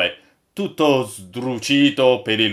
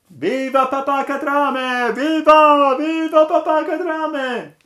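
very high, drawn-out crowd cheer; shrieky slow hurrahs, loud and far above normal pitch; acted cheering; 7 dB louder, slower than usual. A narrator's reading. Crew hailing the old master, cheering in high voices.

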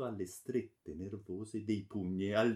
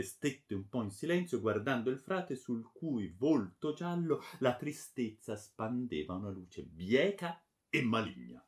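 usual speaking voice

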